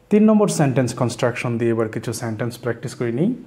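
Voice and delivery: ordinary talk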